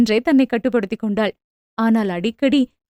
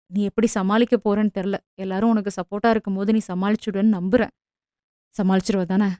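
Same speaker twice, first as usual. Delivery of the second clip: lower, soft husband voice, tender and reassuring; gentle husband; 5 semitones lower, 4 dB quieter than usual. A narrator's reading. Husband comforting his bride before leaving for the army, soft and gentle. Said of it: "என்று மென்மையாக கேட்டான்".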